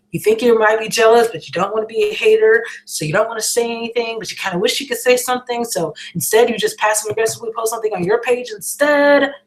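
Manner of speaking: ordinary talk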